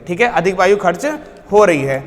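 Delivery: ordinary talk, nothing marked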